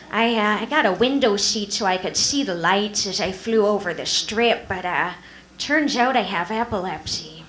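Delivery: sad lateral-lisp Carol Channing voice; sigh, then lisp it out mournfully; slushy lisp. Pretend. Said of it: "she'd be like".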